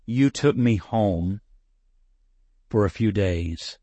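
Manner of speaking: slow, choked-up reading; reading a student's letter, fighting back tears; real tears held back. Real, not acted. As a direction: push slowly through the lump in your throat. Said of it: "I'm gonna cry".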